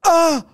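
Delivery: high-pitched yelp; sharp high "ah"; imitated cry. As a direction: mimic a sudden yell of pain. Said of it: "er macht so".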